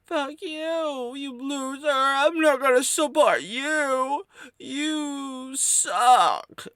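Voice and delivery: high mocking caricature of an online hater. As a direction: screech the insults like a sneering troll. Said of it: "in that tone of voice".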